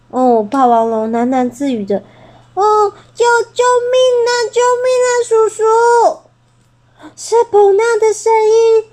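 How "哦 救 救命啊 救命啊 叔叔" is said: much higher, fast panicked screaming; captured little bird screaming; terrified cry for help. Acted.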